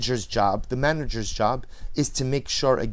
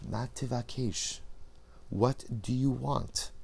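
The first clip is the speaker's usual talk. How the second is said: slow and much softer; soft whisper-like ask; 7 dB quieter, slower than usual. Acted. The Ish gently asking the lost person, slow and soft. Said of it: "whispers into our ear".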